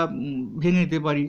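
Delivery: ordinary talk, nothing marked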